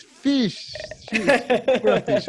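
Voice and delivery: amused, loud self-correction; laughing at the accent slip. Real, not acted. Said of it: "my Spanish accent came".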